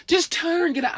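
high, frantic shouting; panicked yell. Acted. Panicking kid to mom in getaway car, frantic yell. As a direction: yell urgently in a freak-out.

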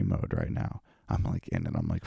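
low voice. Pretend. Full conspiracy mode.

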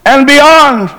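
high answering call, mildly shouted; imitated shout back. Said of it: "would always shout back".

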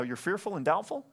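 ordinary delivery

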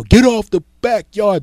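loud high shout at an intruder; yell. Acted. Homeowner with a bat yelling at a burglar, full shout. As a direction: bellow it to scare him off. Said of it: "get a baseball bat and yell".

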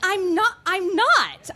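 indignant denial. Acted. Josie accused of pretending by her friend, indignant denial. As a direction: protest loudly at being caught out.

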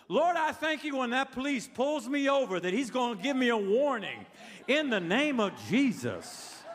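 high, emphatic prayer slowing to a big close; fervent mock prayer. Preacher praying police give a warning, fervent mock prayer. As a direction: pray it boldly for laughs, drawing out the last phrase. Acts out praying for crazy stuff.

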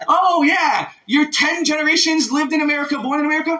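high, overdone sing-song agreement, mocking itself; mocking gushing American. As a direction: gush it like a fawning American, high and mock-sweet. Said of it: "That's how America does it".

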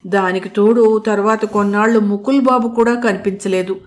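ordinary delivery, nothing marked

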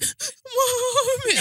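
very high, drawn-out mock wail; imitated crying child. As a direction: wail for mum like a toddler, hammed-up. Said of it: "She's like".

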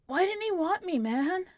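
high, pleading and crying; a teenage boy's broken question; older brother asking why dad left. Acted. Michael crying after Dad left.

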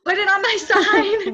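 loud, high order, half laughing; mock demand. Wife ordering husband to place heating pad, mock demanding. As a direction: playfully exaggerate an urgent demand.

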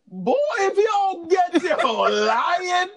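loud, high, drawn-out scolding; hammed-up shout at a liar; mock scolding.

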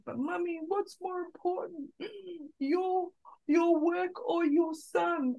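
put-on high, earnest child asking mum, slowed at the end; imitating her small son's plea; little boy's voice. Mother voicing her son asking work or son, high child voice.

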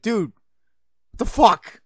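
high, loud retold outburst; exasperated retort. Friend reacting to being yelled at, exasperated. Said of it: "Then I get mad and yell. He's like".

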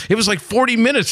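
high, amazed telling; bragging to a friend about the night; incredulous brag. Retold or imitated. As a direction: shoot the pitch up as if you still can't believe it.

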